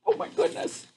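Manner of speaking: high whispered oh my goodness; breathy amazement. Real, not acted. Reader amazed at the grasshopper, high and whispered. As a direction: gasp it under your breath, amazed.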